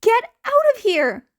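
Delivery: a hero yelling at students to flee, loud; shouting an order. Acted. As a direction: yell at them to run.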